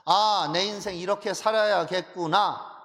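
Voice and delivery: loud, slow, drawn-out cry of resolve; sudden realization. Acted. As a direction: exclaim it as if your eyes just opened. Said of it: "마음의 눈이 열려버려요". (크게 길게 깨달음을 외치듯)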